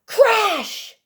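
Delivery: sudden loud high crash. A narrator's reading. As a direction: burst out with the impact. Bird bumps in rain.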